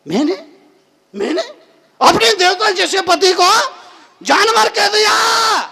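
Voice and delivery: high, loud cry of disbelief; appalled outburst. Acted. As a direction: build to a shout of self-reproach. Same regret repeated in disbelief. (ऊँची आवाज़ में हैरान आत्मग्लानि)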